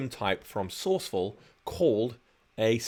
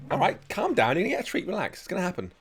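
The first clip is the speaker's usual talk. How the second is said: much higher, coaxing sing-song to the dogs; high pet-talk voice; 8 semitones higher, faster than usual. Real, not acted. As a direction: soothe eager dogs waiting for a treat. Calming excited dogs before treats.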